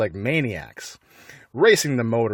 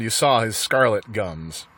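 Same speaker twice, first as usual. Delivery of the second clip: ordinary delivery, nothing marked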